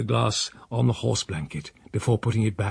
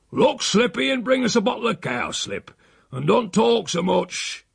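put-on gruff old uncle; 9 semitones higher than usual. Old uncle ordering housekeeper.

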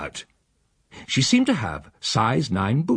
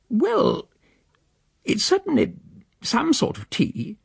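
much higher, slow reluctant admission; grudging lady. Acted. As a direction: concede it sniffily. The female tastes grudgingly.

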